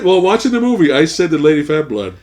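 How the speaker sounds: ordinary delivery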